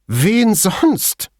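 very high, impatient retort; indignant snort. A narrator's reading. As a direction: snort it back crossly. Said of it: "Wieder schnaubte Veltrini unwillig". (unwillig schnaubend, hoch)

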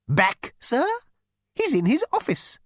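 high, fussy insect voice; insect receptionist. Acted. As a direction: squeak it like a prissy bug.

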